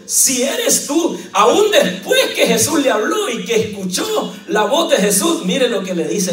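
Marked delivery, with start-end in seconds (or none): 0.1-1.2 doubting Peter. Acted. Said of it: "le respondió Pedro y le dijo".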